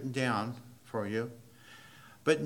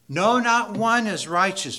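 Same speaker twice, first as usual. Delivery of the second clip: emphatic; 7 semitones higher, 10 dB louder than usual. Shown as a demonstration. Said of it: "as emphatic as he can possibly be".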